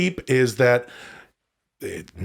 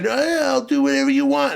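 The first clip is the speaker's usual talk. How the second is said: much higher, fawning yes-man voice; eager yes-man; 13 semitones higher than usual. Retold or imitated. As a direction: gush like an eager pushover. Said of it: "to saying".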